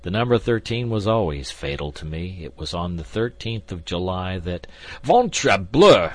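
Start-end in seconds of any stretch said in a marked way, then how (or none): 5.0-6.1 loud, high exclamation cutting in; cried oath. A narrator's reading. D'Artagnan cutting off the gambler's story, crying out. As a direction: explode with an oath. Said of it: "cried D'Artagnan".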